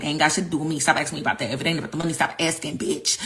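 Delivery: low, indifferent leader's voice dropped well under her own; callous leader. Retold or imitated.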